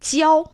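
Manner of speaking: 交 held high and level; first tone. Shown as a demonstration. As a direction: keep 交 up on a steady first tone. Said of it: "that's the first tone".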